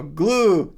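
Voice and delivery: "glue" with a clear oo; correct vowel. Shown as a demonstration. Streamer contrasting two sounds, "glue" with oo. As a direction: say "glue" with the oo of blue. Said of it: "They're totally, ... different sounds".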